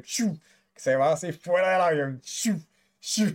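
playful whoosh sound effect; vacuum whoosh. Retold or imitated. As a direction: mimic a jumper sucked out the door. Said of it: "como si fuera un vacuum cleaner".

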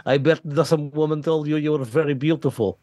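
put-on light Russian accent, teasing flirt; hint of a Russian accent, playful. The comic teases his friend with a Russian accent, lightly done. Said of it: "You literally did a Russian accent".

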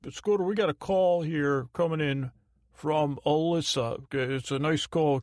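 a lightly put-on sidekick voice; a hint of an in-studio sidekick; co-worker announcing a call. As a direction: interrupt as the producer with a call. Voices his colleague Bob announcing a call.

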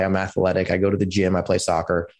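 ordinary talk, nothing marked

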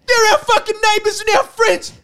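high, loud impassioned protest; outraged shouting. Real, not acted. Ranting podcaster tells co-hosts to leave the Kiwis alone, outraged shouting. Heated outburst defending the Kiwis.

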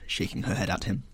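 plain reading voice

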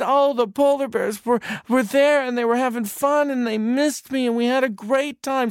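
put-on high, loud gushing child; high giddy child's report; excited little boy. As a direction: pour it all out to dad. Boy tells father about bears.

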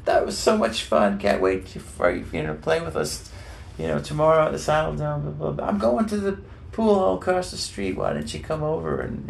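imitating an eager host; excited rock star.

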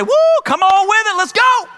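high, fast, loud whoop; hyped cheer. Acted. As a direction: cheer them on like a coach at the finish line. God cheering the believer on.

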